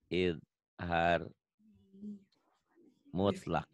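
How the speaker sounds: slow dictation. Shown as a demonstration. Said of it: "ingat-ingat ya".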